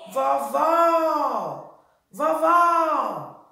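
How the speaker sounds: "vovó" called loudly with a long open "vó"; "vovó" belted with a stretched second syllable; stress on the last syllable. Shown as a demonstration. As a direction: hold the end of "vovó", high and loud. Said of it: "a sílaba mais forte da palavra vovó".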